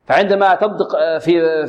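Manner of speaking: ordinary talk, nothing marked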